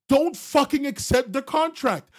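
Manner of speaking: high, loud, slow warning; loud slowed command. Real, not acted. Streamer warning chat never to sign, loud and slow. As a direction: bark the warning out. Scolding chatter.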